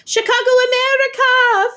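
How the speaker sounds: high loud excited yelp; excited girl's yelp. A narrator's reading. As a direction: burst out with delight. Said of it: "Fiona yelped".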